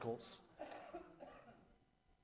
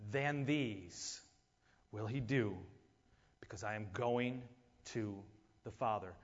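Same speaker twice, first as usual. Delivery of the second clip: softer, slower, every word set apart; quieter slow rereading; 5 semitones lower, slower than usual. Shown as a demonstration. Preacher rereading the verse to the congregation, soft and deliberate. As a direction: read it again gently so they hear each word. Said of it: "I want you to hear every word of this".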